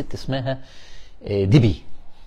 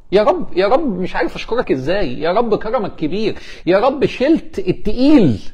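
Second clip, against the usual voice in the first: higher, fervent thanks to God; امتنان متحمس; 6 semitones higher, 4 dB louder than usual. Acted. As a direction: pour out overwhelmed gratitude. Acts out a grateful prayer. (مناجاة شكر بحماس وصوت مرتفع)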